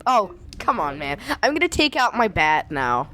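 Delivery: normal talking voice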